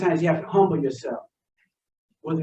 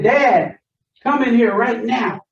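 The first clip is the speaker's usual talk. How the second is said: louder, slower summons of dad over the intercom; retold loud call; 5 semitones higher, 6 dB louder, slower than usual. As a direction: bark for dad to come in right now. Calling his father over the intercom.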